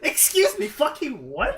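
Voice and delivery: high, loud comic disbelief; mock outrage. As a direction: blurt it out as an appalled commander. Acting out disbelief at Zerglings driving tanks.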